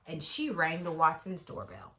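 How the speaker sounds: usual speaking voice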